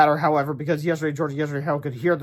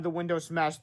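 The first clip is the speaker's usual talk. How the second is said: usual speaking voice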